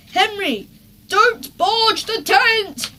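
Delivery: high, loud slow yell; Dad yelling at Henry not to barge. A narrator's reading. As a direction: shout the warning across the camp. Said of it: "yelled Dad".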